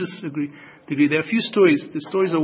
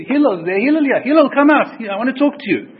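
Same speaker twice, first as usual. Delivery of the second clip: much louder and higher, brazen shouting; rude shout; 8 semitones higher, 7 dB louder than usual. Retold or imitated. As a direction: bellow for him to come out. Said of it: "he was speaking very chutzpahdik".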